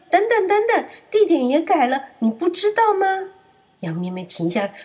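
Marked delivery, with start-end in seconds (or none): 0.1-3.3 much higher, louder call to stop; wolf calling the lamb back; high hammed-up calling wolf. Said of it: "大野狼叫住他：".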